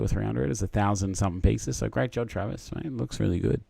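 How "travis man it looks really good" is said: usual speaking voice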